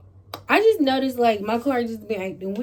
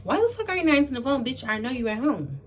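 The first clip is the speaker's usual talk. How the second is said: re-enacted annoyance, rapid and raised; irritated; 6 semitones higher, faster than usual. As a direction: act out fed-up phone nagging, pitched up. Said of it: "now I'm getting irritated".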